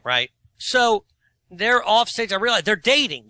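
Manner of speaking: ordinary delivery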